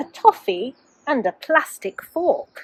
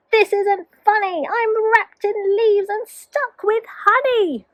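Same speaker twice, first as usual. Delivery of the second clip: high, squeaky outraged wizard; much higher, whiny protest; indignant wizard; 10 semitones higher than usual. Acted. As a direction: squeal like a trapped, furious villain. Wizard trapped, complaining.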